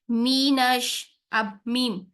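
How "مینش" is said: مینش with a thin ee on م; thin meem. Shown as a demonstration. Teacher demonstrating مینش. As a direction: keep the zer of مینش light, voice dropping. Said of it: "میم کی جو زیر ہے اس کو بھی باریک رکھیں".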